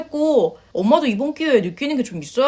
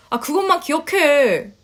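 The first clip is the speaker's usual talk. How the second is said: ordinary talk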